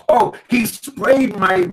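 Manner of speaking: ordinary talk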